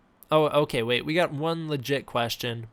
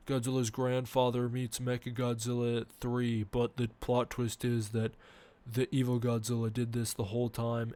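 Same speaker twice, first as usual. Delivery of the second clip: lightly voiced lower-pitched toy channel host; Louis impression; 3 semitones lower than usual. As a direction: drop the voice and mumble through a toy video intro. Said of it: "Do Lewis's voice".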